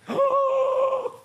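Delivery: high loud theatrical gasp; mock gasp. Preacher mocking the congregation's shock, theatrical gasp.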